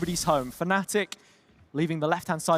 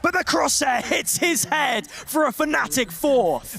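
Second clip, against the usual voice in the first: excited shout; 6 semitones higher, slower than usual. Acted. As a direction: shout the clutch kill. Live call of the clutch kill.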